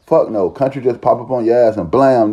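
usual speaking voice

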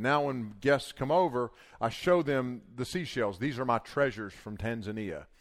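ordinary delivery

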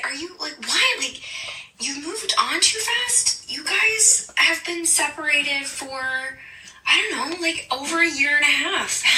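incredulous. Retold or imitated. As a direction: push back in total disbelief. Incredulous retort to boyfriend, retold.

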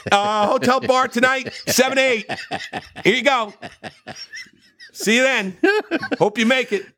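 gleeful announcement. Pretend. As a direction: hand out the invite like a cheeky host. Announcing the bar meetup to crew.

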